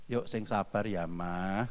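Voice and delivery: soft and low, talking a spouse down gently; calm the wife, voice kept down and soft. Shown as a demonstration. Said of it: "Ya, nadanya diturunkan".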